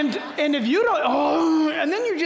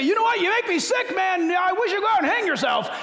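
mock insult; 8 semitones higher than usual. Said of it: "If you were to say".

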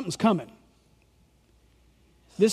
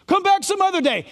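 snooty dismissive ruler, pitched higher; mocking ruler; 8 semitones higher than usual. Preacher mocking the synagogue ruler, sneering. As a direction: sneer it like a proud official.

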